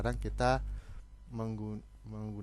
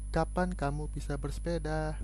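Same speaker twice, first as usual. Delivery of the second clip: "Kapan kamu bisa bersepeda?" read higher, as a clear question; question intonation; 7 semitones higher than usual. Shown as a demonstration. Teacher reads a question example to pupils, higher and marked. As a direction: lift the question for the class. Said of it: "itu juga kalimat tanya".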